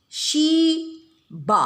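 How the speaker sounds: "She ba" broken in two, SHE held high and loud, ba dropping; syllable stress. Shown as a demonstration. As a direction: demonstrate the syllables of "She ba", stretched and separated. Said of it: "So you put a stress".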